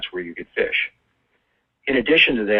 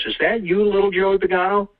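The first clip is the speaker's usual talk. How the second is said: higher-pitched questioning priest; lightly acted priest, pitched up; 9 semitones higher than usual. Priest questions little Joey in confession, in a raised priest voice. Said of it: "And the priest says".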